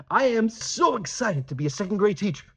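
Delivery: excited exclamation, pitch lifted; real excitement. Shown as a demonstration. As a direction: gush it like an exclamation. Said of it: "you're showing real excitement".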